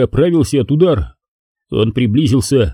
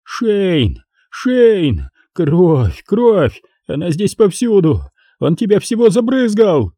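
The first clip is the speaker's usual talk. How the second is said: higher and higher, yelling in blind panic; panicked yell; 7 semitones higher, slower than usual. Acted. Напарник в ужасе кричит Дантеку, яростный крик. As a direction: scream it out as the blood sprays. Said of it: "заорал благим матом".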